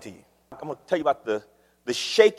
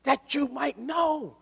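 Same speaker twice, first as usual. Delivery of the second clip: voice of God; 8 semitones higher, 4 dB louder than usual. Retold or imitated. God pleading with his people through the prophets, earnest. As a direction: speak as God longing to be known. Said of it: "God is saying over and over".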